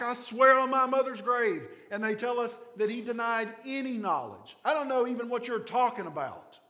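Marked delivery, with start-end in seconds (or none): 0.0-1.7 higher, louder swearing oath; mock oath. Pastor voicing Peter's oath, loud and insistent. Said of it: "You and I might say something like". 4.6-6.6 louder, higher insistent denial. Retold or imitated. Peter denying before the crowd, insistent and raised.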